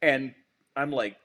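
ordinary talk

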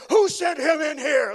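booming mock outburst; angry doctor's shout. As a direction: imitate a furious doctor pointing someone out. Acts out the angry cardiologist.

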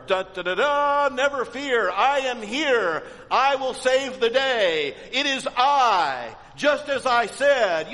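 high, loud, mock-heroic fanfare voice; hammed-up superhero. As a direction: play a cartoon hero swooping in to save the day. Mock triumphant hero entrance.

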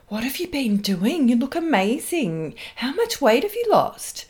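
put-on high, gushing valley girl. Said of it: "sounding like a valley girl".

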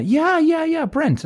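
high-pitched bluffing yeses; eager agreement. Retold or imitated. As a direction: jump on the suggestion eagerly. Bluffing along with receptionist's guess.

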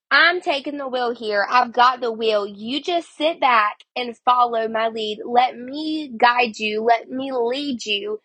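ordinary talk, nothing marked

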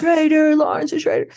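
playfully brand her a traitor; mock accusation. [name] teasing Lauren, mock accusing traitor. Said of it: "I'm like".

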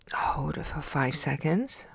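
soft unvoiced whisper. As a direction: breathe the words out in a whisper. Whispered repeat of breath cue.

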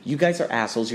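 ordinary delivery

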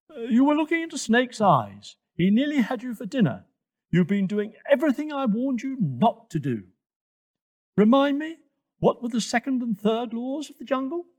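high, brisk, insistent telling-off; scolding Twigger the giraffe. Acted. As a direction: reprimand a youngster, pitched high.

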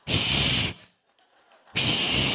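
sustained "shhh"; drawn-out shush. Acted. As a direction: shush someone firmly. Hushing the complaint mid-sermon.

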